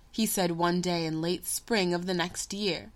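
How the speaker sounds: usual speaking voice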